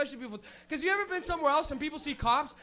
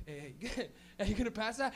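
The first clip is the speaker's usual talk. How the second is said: softer, lower, lazy and laid-back; low stoned mumble; 4 semitones lower, 8 dB quieter than usual. Retold or imitated. Stoner in the front seat asking for the joint, low and soft. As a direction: ask for the joint half asleep. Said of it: "He'll be like".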